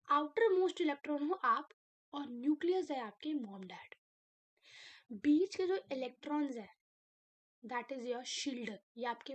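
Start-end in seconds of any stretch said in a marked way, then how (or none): none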